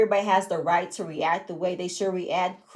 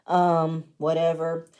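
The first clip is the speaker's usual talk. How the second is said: normal talking voice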